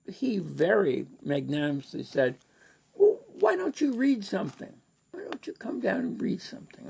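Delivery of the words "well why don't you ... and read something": high, breathy whispered invitation; Ken's invitation. Retold or imitated. Ken gently urging the Canadian to read at Bloomsday. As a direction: coax him in a hushed high voice.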